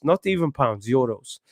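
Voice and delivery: put-on a light Portuguese accent on "not even pounds", dropping soft; a light Portuguese accent with "euros" trailing off low and soft; a smug Portuguese-accented "not even pounds, euros"; Mourinho impression. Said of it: "I sounded like I was prime Portuguese".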